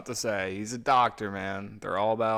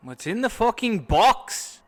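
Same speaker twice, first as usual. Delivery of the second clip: put-on a much higher, louder yell in a hammy Irish accent; shouted Irish accent; 10 semitones higher, 9 dB louder than usual. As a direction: scream it in a bad Irish accent. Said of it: "Oh, that was a bad Irish accent".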